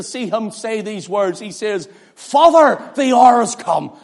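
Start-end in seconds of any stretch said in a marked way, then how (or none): 2.2-4.0 Father called out high and loud, a strong proclamation; an open, ringing plea; loud cry. Shown as a demonstration. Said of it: "I wonder how he said it".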